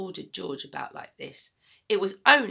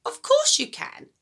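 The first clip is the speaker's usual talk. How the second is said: a high, loud granny snap; Grandma snapping at the boy, high and loud; snapped; 7 dB louder than usual. Acted. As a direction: bite back sharply like a sour old woman. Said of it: "she snapped".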